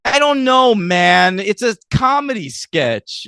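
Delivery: loud, high-pitched comic protest; mock exasperation. Real, not acted. Host answering why the bird died, exasperated outburst. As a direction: throw up the question, voice jumping high.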